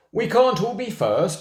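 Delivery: high, loud bragging. Acted. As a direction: crow it proudly, showing off. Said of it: "Harry bragged".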